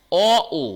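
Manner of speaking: teacher calling out the vowels অ, ও for the class; vowel demo. Shown as a demonstration. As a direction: sound out অ then ও clearly. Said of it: "এর কিন্তু নির্দিষ্ট বর্ণ নেই".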